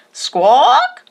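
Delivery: high bright squawk like a macaw; parrot squawk. A narrator's reading. The kite parrot greeting the boys with a squawk. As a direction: screech it like a parrot.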